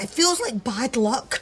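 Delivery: usual speaking voice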